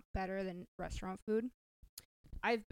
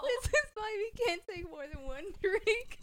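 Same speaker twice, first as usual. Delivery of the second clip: giggly; 12 semitones higher, 6 dB louder than usual. Real, not acted. Giggling after drinks.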